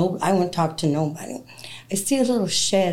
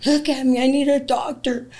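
desperate plea; 7 semitones higher than usual. Retold or imitated. Begging cousin for doctor.

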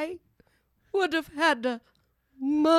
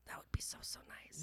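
whisper; 20 dB quieter than usual. Real, not acted. Dreamy reply to being applauded.

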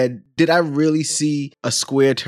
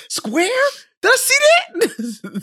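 very high, incredulous squeak; baffled; slower than usual. Retold or imitated. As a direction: shriek it in disbelief. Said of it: "He's like".

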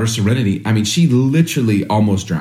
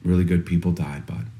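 softer, gentle comfort to the son; soft apology; 5 semitones lower, 6 dB quieter than usual. Retold or imitated. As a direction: comfort the crying son quietly. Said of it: "I'm like".

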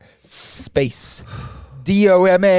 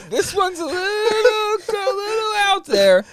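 laughing hard; 13 semitones higher than usual. Real, not acted. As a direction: squeeze it out through laughter.

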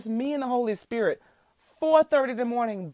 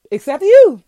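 sudden loud, high "except you"; spring it on the listener like a secret gift; playful burst; 8 semitones higher, 17 dB louder than usual. Real, not acted. Preacher teasing podcast listeners about his secret, loud and playful.

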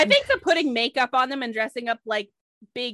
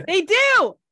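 loud, higher protest; emphatic insistence; 7 semitones higher, 7 dB louder than usual. Real, not acted. Insisting to a doubting friend that they use caulk, emphatic. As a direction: insist it, raised and louder.

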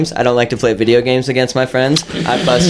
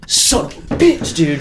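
mock curse; 5 semitones higher, slower than usual. Retelling fuming after a lost match.

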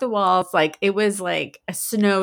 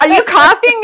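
loud, high mock accusation; incredulous challenge; 10 semitones higher, 10 dB louder than usual. Mom challenges daughter at the door, incredulous. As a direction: call out the faker, voice raised. Retold suspicion of fake cough.

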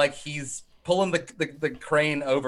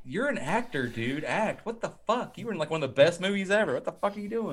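softer, laughing disbelief; hushed incredulity; 4 semitones higher, 7 dB quieter than usual. Retold or imitated. Viewer scolding the actor in softer disbelief. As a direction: mutter your outrage at the screen. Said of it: "I'm like".

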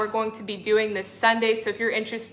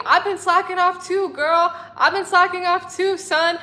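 much higher, teasing reassurance; playful high tease; 8 semitones higher than usual. Pretend. Playful self-reply to viewers.

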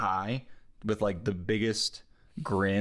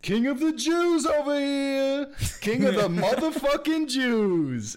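high, loud, drawn-out hollering; screaming crowd; 5 dB louder, slower than usual. Acted. Crowd hailing Jesus into Jerusalem on a donkey, screaming. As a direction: yell it like a rowdy mob hailing a hero. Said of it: "they're basically just screaming".

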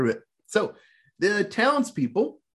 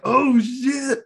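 a high, louder yelp of alarm; mock surprise; 8 semitones higher, 4 dB louder than usual. Viewer reacting to the hero's revenge, high startled yelp. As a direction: yelp it like the villain just got caught. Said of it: "reacting to the revenge reveal".

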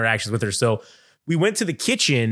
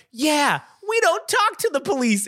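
put-on much higher, sassy kid voice; kids' cheeky chorus; 13 semitones higher than usual. Kids repeating the line to the sheriff, in a high sassy kid voice. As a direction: imitate kids copying the sass.

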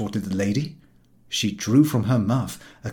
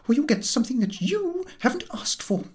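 menacing lady. Acted. Lady threatens the chief.

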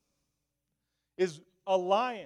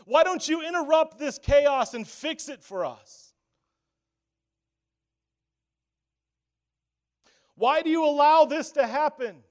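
loud, high, pleading shout; crying out to God; 7 semitones higher, 8 dB louder than usual. Pretend. Preacher voicing the congregation's angry cry to God, loud pleading. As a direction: yell up at heaven demanding answers. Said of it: "We cry out and say to God and yell to him".

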